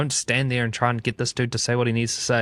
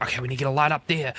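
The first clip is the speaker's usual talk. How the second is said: rushed, higher mock fluster; stressed out; 3 semitones higher than usual. Crew arriving on location, imitated stressing out. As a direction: imitate a frazzled crew member rattling off tasks. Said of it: "Oh, and they're stressing out".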